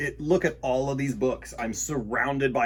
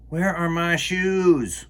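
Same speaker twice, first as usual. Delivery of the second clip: slow, louder, a little higher plea; lightly voiced asking child; 5 semitones higher, 5 dB louder, slower than usual. Reading a child asking for shoes, slow and louder. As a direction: ask like a kid who lost shoes. Voicing a character's question.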